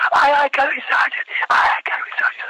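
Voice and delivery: high, strained recruit sound-off; yelled acknowledgement. Retold or imitated. Candidate answering the sergeant in his face, imitated strained yell.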